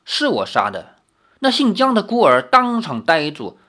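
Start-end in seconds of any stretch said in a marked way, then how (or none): 0.0-1.0 low, cool confession; calm, lower-pitched, unmistakably clear; clear, deliberate admission. A narrator's reading. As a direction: state it plainly and distinctly. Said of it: "一清二楚地说".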